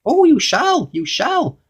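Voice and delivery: very high, gleeful goblin assurance; grinning goblin. A narrator's reading. Goblin promising Mister Uppity an audience, grinning high voice. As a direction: squeak it knowingly through a grin. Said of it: "grinned the goblin".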